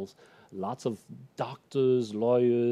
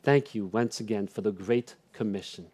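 earnest spoken prayer, rising in fervour; closing prayer; 3 semitones higher than usual. Acted. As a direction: pray over the congregation.

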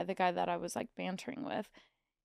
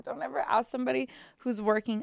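ordinary talk

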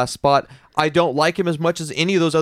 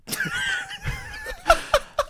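burst of laughter; 7 dB quieter than usual. Real, not acted. Breaks up before his promo.

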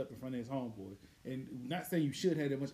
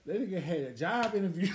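ordinary talk

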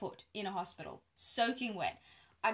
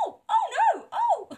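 loud, high shocked cries; mock horrified exclamations; alarmed midwife; 11 dB louder than usual. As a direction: yelp in dismay at a sudden disaster. Midwife discovers the flooded room.

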